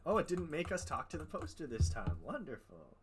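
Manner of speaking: ordinary talk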